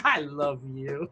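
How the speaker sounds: soft, slow loving murmur; tender. Acted. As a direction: say it like to a pet. Tenderly tells robot love.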